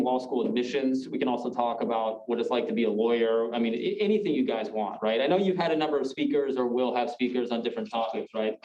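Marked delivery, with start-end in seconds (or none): none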